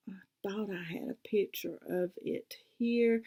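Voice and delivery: whispered to herself while searching; half-voiced whisper, searching. Real, not acted.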